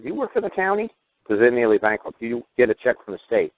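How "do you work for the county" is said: high-pitched eager mock question; gleefully feigned curiosity, voice lifted high; smiling mock delight. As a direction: ask it high, with playful innocence. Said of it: "I just smile like crazy".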